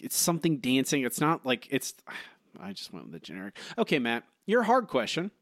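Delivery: usual speaking voice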